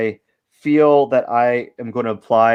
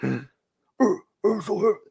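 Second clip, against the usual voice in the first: much higher, slow, strained muscle-guy voice; mock strongman; 9 semitones higher, much slower than usual. Artist voicing the bodybuilder, slow high strain. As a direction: whine it out under the weight. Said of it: "He's like".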